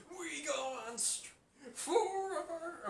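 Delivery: high, very slow, drawn-out whine; striking SpongeBob. Retold or imitated. Fan imitating SpongeBob on strike, slow high whine. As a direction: stretch forever out in protest. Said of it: "they just go on strike".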